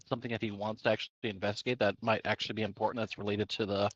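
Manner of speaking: usual speaking voice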